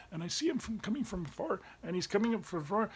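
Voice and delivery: usual speaking voice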